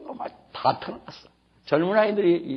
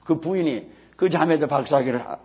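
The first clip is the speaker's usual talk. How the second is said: normal talking voice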